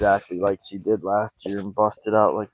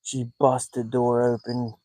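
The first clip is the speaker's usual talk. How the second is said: ordinary talk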